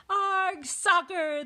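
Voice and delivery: high, loud echoed cry; crew yelling. A narrator's reading. Crew follows captain into the game, high yell. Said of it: "the crew yelled".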